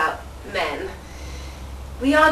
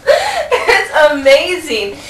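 laughing it out, slow and a bit louder; 6 semitones higher, 7 dB louder, much slower than usual. Acted. Presenter laughing at her puppy-man, slow. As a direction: laugh through the words.

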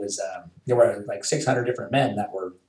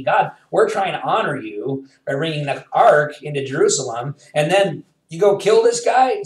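upset protest; 5 semitones higher, 5 dB louder than usual. Retold or imitated. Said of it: "David was upset".

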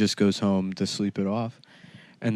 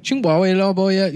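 high, loud fake Chinese; mock Chinese; 10 semitones higher, 5 dB louder than usual. Chinese woman turning to him from the next table, mock Chinese. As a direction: pitch up and rattle nonsense Chinese syllables. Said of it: "starts speaking to him in Chinese".

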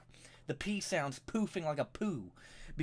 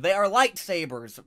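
louder, "are" hit hard; loud correction; 8 dB louder than usual. Real, not acted. Fan correcting people who say lightsaber, loud insistence. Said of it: "making fun of people that call them".

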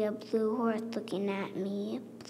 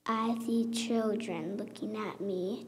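ordinary delivery

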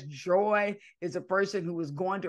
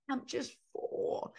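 whispered, softer, a bit higher and slow; quiet overwhelmed reply; 7 semitones higher, 8 dB quieter, slower than usual. Retold or imitated. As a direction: say it as if full of tears. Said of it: "all she could say was".

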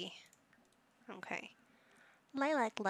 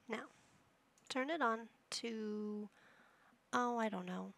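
normal talking voice

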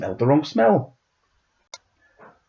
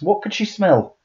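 ordinary delivery, nothing marked

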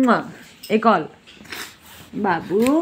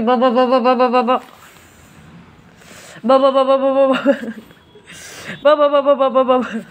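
playful babbling. Real, not acted. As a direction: babble at the dog to tease her. Babbling noise at dog. (कुत्ते को छेड़ते हुए "बा बा बा" की बड़बड़)

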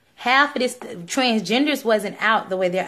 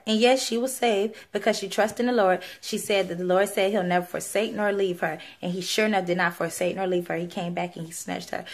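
normal talking voice

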